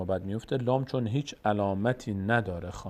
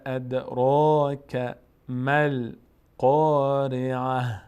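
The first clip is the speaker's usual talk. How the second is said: slow chanted recitation, louder; Quran recitation; 4 dB louder, much slower than usual. Shown as a demonstration. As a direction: chant the verse slowly. Recites verse. (تلاوت آهسته و بلند)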